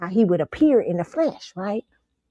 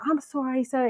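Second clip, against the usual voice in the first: apologizing grandpa; 6 semitones higher, 4 dB quieter than usual. Retold or imitated. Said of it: "He said".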